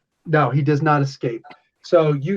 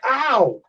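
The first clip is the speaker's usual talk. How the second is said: high-pitched cry of "ow"; acted pained yelp; 7 semitones higher than usual. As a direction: yelp as if just hurt. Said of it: "jump up and be like".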